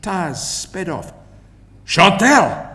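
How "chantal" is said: calling out. A narrator's reading. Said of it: "Stefan's voice caught".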